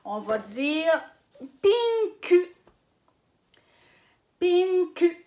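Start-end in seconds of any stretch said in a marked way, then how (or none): 1.6-2.6 pinku in two beats with a full final "ku", high and loud; U final prononcé. Shown as a demonstration. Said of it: "on va prononcer le U". 4.4-5.2 pinku in two beats with a full final "ku", high and loud; U final prononcé. Shown as a demonstration. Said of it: "on va prononcer le U".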